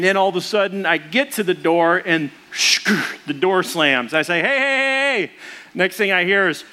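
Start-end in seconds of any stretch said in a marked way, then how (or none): 4.4-5.3 calling out. Retold or imitated. Dad locked out, calling kids.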